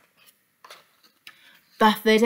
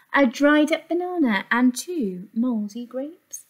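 ordinary delivery

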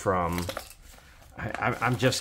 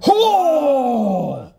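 sudden high yelp of surprise; surprised shout; 9 dB louder than usual. Real, not acted. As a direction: shout out in shock at the find. Opens box, finds silver.

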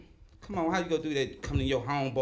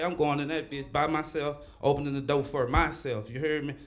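usual speaking voice

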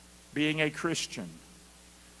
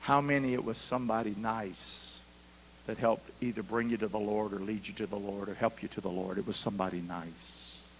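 soft reflection; 10 semitones lower, 9 dB quieter than usual. Real, not acted. Preacher goes soft, reflective.

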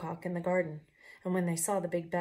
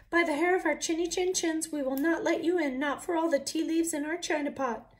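high, loud, defiant chant; little wolves' voice; 9 semitones higher, 6 dB louder than usual. Acted. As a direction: stand firm in tiny high voices. Wolves refusing the pig.

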